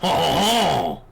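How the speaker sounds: bad French accent. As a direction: proclaim indignantly in a wobbly mock French accent. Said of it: "wasn't really a very good French accent".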